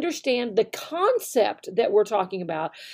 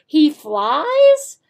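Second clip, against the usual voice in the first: questioning tone; 3 semitones higher, 7 dB louder than usual. Shown as a demonstration. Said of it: "showing with our tone of voice which one is the correct answer".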